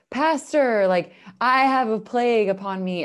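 loud, high, theatrical plea to the pastor; dramatic plea. Pretend. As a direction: ham it up like a desperate sinner. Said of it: "like, really dramatically and be like".

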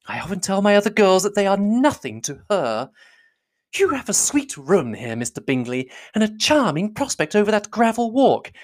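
high, gushing, fussy mother; high-pitched matron running on effusively; profuse thanks. A narrator's reading. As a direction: lay on gratitude and flattery thickly. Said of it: "was profuse in her acknowledgments".

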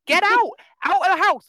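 high, loud, fed-up plea; exasperated yell. Retold or imitated. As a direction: scold the characters, exasperated. Yelling at horror movie characters.

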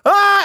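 high loud mock scream; imitated old man's yell. Host imitating the neighbour's startled yell, high and loud. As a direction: yelp like he's falling off a cliff. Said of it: "Like you're falling off a cliff".

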